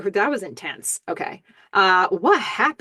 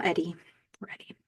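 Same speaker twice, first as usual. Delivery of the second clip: whisper; 10 dB quieter, faster than usual. Real, not acted. Aside after the kiss.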